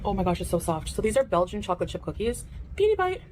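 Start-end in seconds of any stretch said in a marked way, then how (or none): 2.8-3.2 catchphrase. Acted. Catchphrase before biting food.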